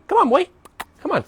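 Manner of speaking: bright high-pitched call to the dog; sing-song dog call; high coaxing call. Real, not acted. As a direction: coax the dog along, voice way up.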